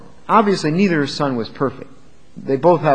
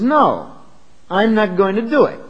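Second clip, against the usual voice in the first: a belligerent, rebellious no from the son; mock defiant son; defiant refusal; 6 semitones higher than usual. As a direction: throw the refusal back at your father, mildly. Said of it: "belligerent. It's rebellious. It's defiant. It's rude".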